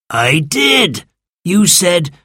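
put-on slow, weary old creature; slow sand fairy voice. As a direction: drag it out like ancient history.

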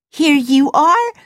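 high gushing servant; delighted servant. A narrator's reading. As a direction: present the lamp proudly. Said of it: "she said".

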